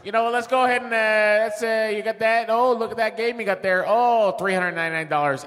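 rambling Chicago-accent sales patter. Shown as a demonstration. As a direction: do a gum-chewing Chicago game seller. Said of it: "Now do the Chicago accent".

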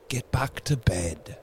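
subdued, uneasy order; unsteady. A narrator's reading. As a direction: say it unsteadily, holding back. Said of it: "he said unsteadily".

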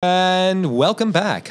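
high, drawn-out "and welcome back"; show-host welcome. Pretend. Host reopening the show after the break, high drawn-out welcome. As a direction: stretch it out high like a host's intro.

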